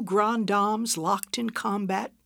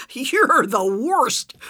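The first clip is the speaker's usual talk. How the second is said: breathless, slow, louder whisper between laughs; gasping through laughter; 5 semitones higher, 7 dB louder, much slower than usual. Acted. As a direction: gasp it out mid-laugh. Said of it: "Margaret gasped".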